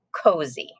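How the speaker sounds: ordinary talk, nothing marked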